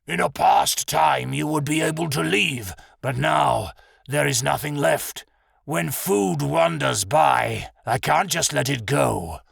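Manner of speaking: slow, drawn-out beast voice; threatening bear character voice; menacing bear. Acted. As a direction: play a big hungry bear, drawing words out.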